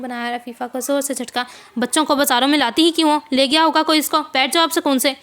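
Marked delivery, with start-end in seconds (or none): 1.8-5.2 loud, dismissive scolding; rude stranger. Acted. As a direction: brush off the pestering woman. Rude man brushing her off. (झुंझलाया हुआ आदमी, ऊँची आवाज़ में झिड़कता हुआ)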